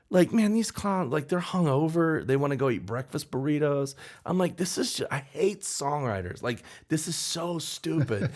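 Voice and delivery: high-pitched, whiny mock rant; exasperated griping. Boyfriend griping by phone about hungover co-writers, exasperated.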